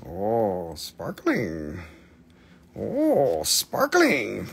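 low oohs with a swooping "sparkling"; playful sparkly cooing. Pretend. Seller admires sparkly stones for viewers, playful swooping coo. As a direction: coo over it with a big swoop in pitch.

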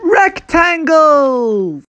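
ordinary talk

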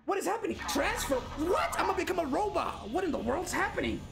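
high, bewildered streamer reaction; can't believe what's happening to you; astonished. Real, not acted. Soul moves into a robot.

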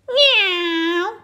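high squeaky meow. Acted. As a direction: mew like a kitten. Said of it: "went Baby Bear".

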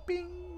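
high little ding; a tiny ding as they vanish; cartoon ding. Sparkle sound as they vanish.

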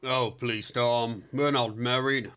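ordinary delivery

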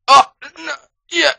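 loud, high sudden "oh no"; startled cry. Real, not acted. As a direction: jump at a scare. Sudden fright in game.

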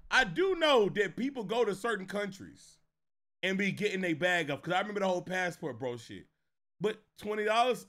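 ordinary delivery

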